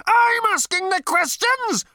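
very high, loud, slow furious howl; howled in fury. A narrator's reading. Number two howling at Ford in fury. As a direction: howl it with apoplectic rage. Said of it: "howled number two".

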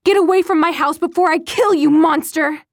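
loud, high, savage threat; mother screaming at her son to leave; threatening scream. Acted. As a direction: scream the threat with terror and hate.

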